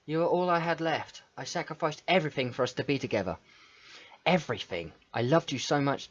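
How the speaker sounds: soft, wounded reproach; mildly hurt Monica. Acted. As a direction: read her accusations gently, a touch betrayed. Reads Monica's accusations.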